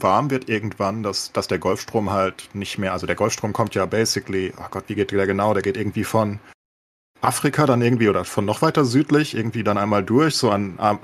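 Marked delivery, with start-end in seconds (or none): none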